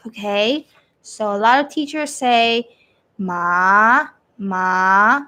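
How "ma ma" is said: "Ma" starting low and sliding up; second tone, rising. Shown as a demonstration. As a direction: lift "ma" steadily to the end. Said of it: "the second tone going up".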